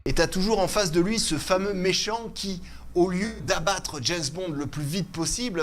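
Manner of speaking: usual speaking voice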